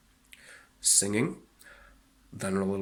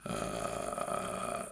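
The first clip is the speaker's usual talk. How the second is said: vocal fry; 12 semitones lower, 7 dB quieter than usual. Shown as a demonstration. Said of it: "that's vocal fry".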